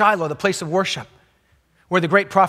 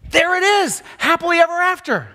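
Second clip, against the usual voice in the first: much higher, bright mock announcement; mock triumphant; 9 semitones higher than usual. Real, not acted. Preacher mocking the tidy reading of the story, much higher. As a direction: sing out the fairy-tale ending tongue in cheek.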